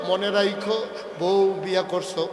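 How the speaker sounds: usual speaking voice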